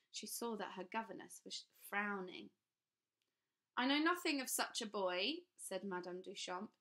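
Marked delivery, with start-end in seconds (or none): 3.8-5.4 firm, raised and loud; firm denial. A narrator's reading. Madame Duchamp denies to the men, firmly. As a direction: Madame flatly shuts the question down.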